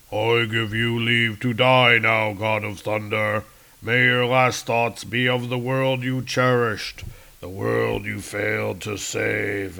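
slow, drawn-out villain's gloat; gloating Galactus. Acted. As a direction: gloat grandly over a beaten foe. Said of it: "Galactus is gloating here".

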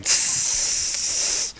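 long snake-like hiss; sustained "tsss" through the teeth; imitated hiss. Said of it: "he's hissing really loud".

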